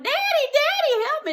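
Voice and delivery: very high, panicked cry; girl dragged by dogs crying out for her daddy; calling for help. Retold or imitated. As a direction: call for your daddy while being dragged.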